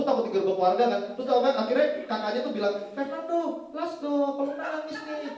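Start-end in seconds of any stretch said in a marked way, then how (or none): 3.0-5.3 put-on high, childlike pleading; mock little girl pleading. Older sister urges the babysitter to let the crying boy, high childish plea. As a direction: beg him to give in.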